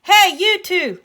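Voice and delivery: high, loud, commanding yell; shouted. A narrator's reading. Uncle chasing the two bullies, commanding shout. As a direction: yell after someone running off. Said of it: "he shouted".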